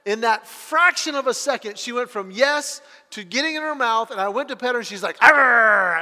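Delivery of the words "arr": sudden loud imitated growl; dog growl. Dog snapping as owner goes to pet her, growling. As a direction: growl hard like a little dog guarding a bone. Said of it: "you're gonna growl at me".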